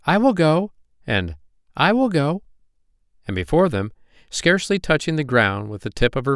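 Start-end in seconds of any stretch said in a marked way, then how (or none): 0.0-0.7 high, raised story voice; loud declaration. A narrator's reading. Older fairies volunteering in a group, loud and high. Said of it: "saying loudly". 1.8-2.4 high, raised story voice; loud declaration. A narrator's reading. Older fairies volunteering in a group, loud and high. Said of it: "saying loudly".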